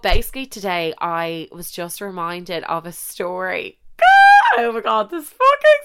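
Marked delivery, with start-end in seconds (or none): 3.8-4.5 a high squealing laugh, very loud; burst of laughter. Real, not acted. Laughing at her own story, high squealing laugh. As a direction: break into a shrieking laugh.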